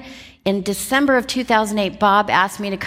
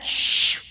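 whooshing "shh" of sent email; long airy hushing whoosh; imitated send noise. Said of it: "you know that noise?".

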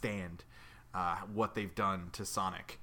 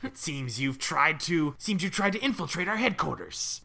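villain voice; 7 semitones higher, 6 dB louder than usual. Acted. Voicing Eggman.

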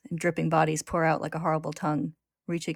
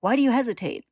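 high, loud, exasperated demand; yelling; 7 semitones higher, 5 dB louder than usual. A narrator's reading. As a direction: yell the question at a dithering ally. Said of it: "he yells, pushing Ed out".

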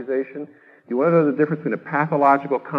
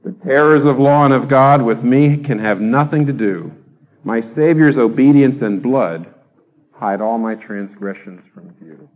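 louder, measured hymn recitation; reciting a hymn; 6 dB louder than usual. Acted. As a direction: declaim the old hymn with weight. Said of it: "John Newton says".

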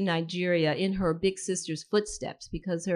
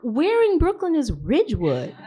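high, loud puzzled question; imitated puzzled friend; 6 semitones higher, 6 dB louder than usual. Friend asks where Ridgewood is, imitated bafflement. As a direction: ask it loudly, pitched up and baffled.

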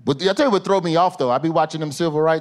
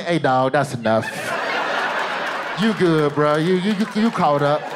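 on-screen prompt. Acted. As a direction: gently talk a buddy out of more viewing. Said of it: "a prompt should just come up on the screen".

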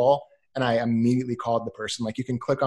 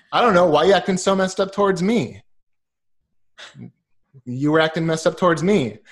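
louder mock retort, echoing his words; Zoolander comeback; 4 semitones higher, 4 dB louder than usual. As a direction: throw it right back at him, playfully. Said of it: "like in Zoolander".

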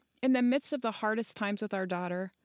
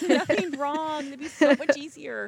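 laughing; 6 semitones higher, 8 dB louder than usual. Real, not acted. Laughing at her own joke.

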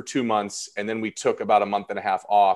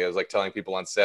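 ordinary delivery

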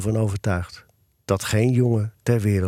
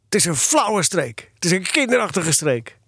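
put-on indignant, raised-pitch boy's protest; high-pitched sulky outburst; sudden outburst; 6 semitones higher than usual. A narrator's reading. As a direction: burst out with it, stretching the words. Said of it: "barst hij opeens uit".

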